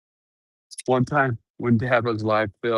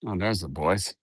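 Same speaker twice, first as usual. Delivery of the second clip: ordinary talk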